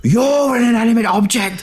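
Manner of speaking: screaming. Retold or imitated. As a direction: shriek it back at her, mock furious. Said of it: "screaming at his wife".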